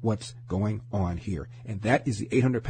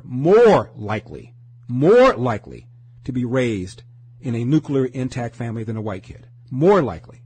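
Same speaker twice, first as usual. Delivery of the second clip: MORE likely slowed and loud; stress on more likely; 6 dB louder than usual. Real, not acted. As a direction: lean hard on "more likely". Hammering the point.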